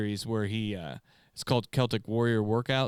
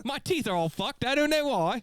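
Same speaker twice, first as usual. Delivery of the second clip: very high, silly fake British accent; hammed-up squeaky British caricature; bad British accent; 14 semitones higher than usual. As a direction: keep up a hopeless British accent. Continues the bad British accent bit.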